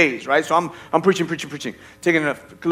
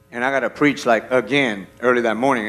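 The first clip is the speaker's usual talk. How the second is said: ordinary talk, nothing marked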